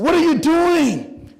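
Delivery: high, loud, drawn-out demand; exasperated scolding. Retold or imitated. Coach confronting the young relay runners, exasperated scolding. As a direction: stretch it out like a fed-up coach yelling.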